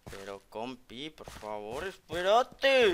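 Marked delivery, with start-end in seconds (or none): 1.9-2.9 drawn-out call. Acted.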